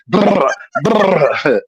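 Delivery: high trilled burst, twice; mouthed flurry of lashes; whipping noise imitation. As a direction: trill it like many switches landing. Imitating twenty switches striking.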